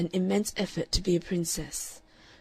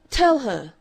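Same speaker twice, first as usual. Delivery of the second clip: imperious; 5 semitones higher, 8 dB louder than usual. A narrator's reading. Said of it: "with an imperious gesture".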